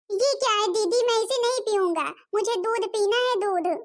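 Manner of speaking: put-on high-pitched child, insisting; a small boy demanding from his sister; little boy refusing. Little boy refuses tea, wants milk. (ज़िद करते छोटे बच्चे की आवाज़)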